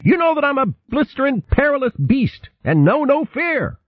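high, squeaky blustering boast; weasel voice. A narrator's reading. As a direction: puff up like a cocky little weasel. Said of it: "a pretty fair imitation of the weasel Ermy".

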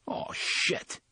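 breathy whispered oh shit; whispered curse. Acted. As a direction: hush it out in dismay. Dismay at lost gun.

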